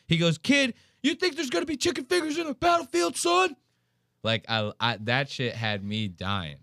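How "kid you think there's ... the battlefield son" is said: high-pitched incredulous scolding; hammed-up combat trainer disbelief; mock drill instructor. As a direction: imitate an instructor dressing down a clueless kid. Instructor scolds kid in retold story.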